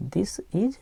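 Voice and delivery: whispered repeat of this is. Shown as a demonstration. Teacher whispering this is to the class. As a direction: breathe the words this is with no voice. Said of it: "teacher repeats the reading".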